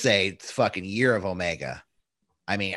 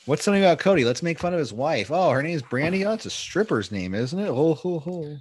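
mocking sarcasm; 6 semitones higher than usual. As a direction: sneer it out mockingly. Mocking Dan Lambert's logic.

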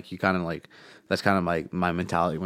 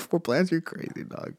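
much higher, incredulous; mock disbelief; 10 semitones higher, 3 dB quieter than usual. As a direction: scoff at the idea. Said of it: "I'm like".

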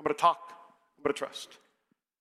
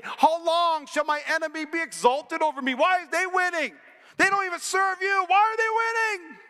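indignant lament; 8 semitones higher than usual. Acted. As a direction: pitch climbing from raised to a high protesting shout. Acting out David's lament to God.

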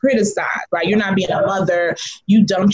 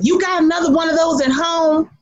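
grandma's taunt; 7 semitones higher than usual. Retold or imitated. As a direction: call it out across the car at the biker. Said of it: "she leans over to me and says".

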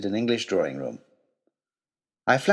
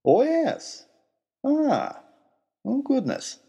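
put-on high, flustered old professor realizing his error; dawning realization, embarrassed; sheepish; 12 semitones higher, much slower than usual. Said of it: "smiled sheepishly".

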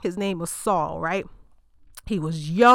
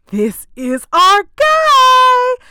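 excited proclamation; 11 dB louder, much slower than usual. Acted. Samuel sure of Eliab.

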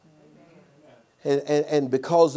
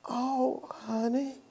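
quiet, gentle "oh, honey"; soft answer; 7 semitones higher, 9 dB quieter, much slower than usual. Retold or imitated. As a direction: practise the tender reply softly. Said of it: "A soft answer".

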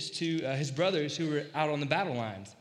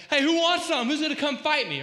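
loud, high taunting call across a valley; Goliath's taunt; 8 semitones higher, 6 dB louder than usual. Retold or imitated. Goliath yelling across the valley at the Israelites, loud taunt. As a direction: yell a challenge, spoiling for a fight.

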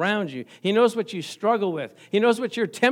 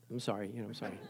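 quiet apology; 10 semitones lower, 18 dB quieter, much faster than usual. Retold or imitated.

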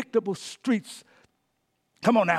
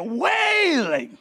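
long, high, loud drawn-out cry on the word; wailing; 9 semitones higher, 6 dB louder than usual. Acted. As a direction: stretch it out into a wail. Preacher acts out the word wailing.

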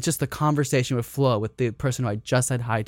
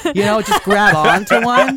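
laughing; 6 semitones higher, 6 dB louder than usual. Real, not acted. Laughing exaggerated question.

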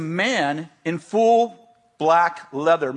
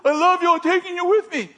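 retold yelling; 11 semitones higher than usual. Pursuer yells at fleeing girl as he chases her, high urgent yelling. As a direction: call it out high and forceful. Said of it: "he's yelling something".

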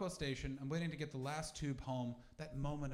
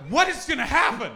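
high, loud, startled cry; alarmed disbelief; 10 semitones higher, 20 dB louder than usual. Retold or imitated. Comic watching the platform scene, high startled outburst. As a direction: yelp it in shocked confusion.

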